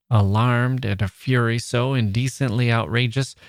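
ordinary delivery

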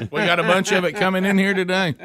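ordinary talk

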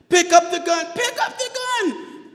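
very high, strained yell. Retold or imitated. Tearful MP yelling at the driver to obey. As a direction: yell the order insistently. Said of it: "while he's yelling for me".

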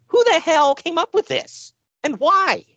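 high, slow, incredulous; hammed-up shocked disbelief; baffled disbelief. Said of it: "we're like".

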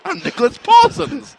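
high, slow, pompous reply; Nicholas Parsons. Retold or imitated. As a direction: answer haughtily, drawing it out. Voicing the offended host.

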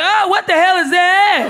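high, loud and slow in mock shock; a comic reeling at her first sight in bed. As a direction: recoil with a shocked laugh. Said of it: "I was like".

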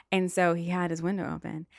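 usual speaking voice

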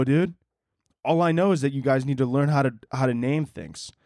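usual speaking voice

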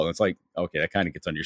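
ordinary delivery

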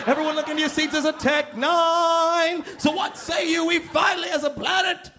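high, slow shouting rally voice; booming rally speech. Acted. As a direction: rouse the crowd like a demagogue. Rallying the planet against aliens.

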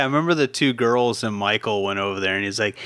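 ordinary talk

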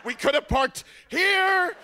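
high, loud, slow, dramatic lament over each parking spot; milk it like a tragic Macbeth speech; mom's theatrical wail. Retold or imitated. Mom wailing at dad about a missed parking spot, high, loud and theatrical. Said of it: "my mom put on a regional production of Macbeth".

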